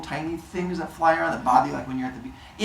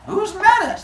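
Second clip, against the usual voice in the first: very high, louder mock-innocent question; feigned innocence; 6 dB louder than usual. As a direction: play dumb in a high squeaky voice. Zeus playing dumb.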